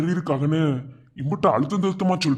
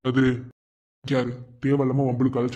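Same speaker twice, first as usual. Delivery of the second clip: ordinary talk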